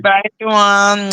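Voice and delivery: normal talking voice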